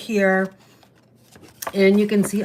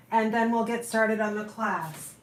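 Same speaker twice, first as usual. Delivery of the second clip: ordinary talk, nothing marked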